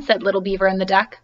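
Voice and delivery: usual speaking voice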